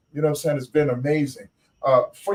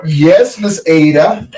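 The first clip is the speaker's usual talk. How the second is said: playful loud answer; 2 semitones higher, 9 dB louder, slower than usual. Pretend.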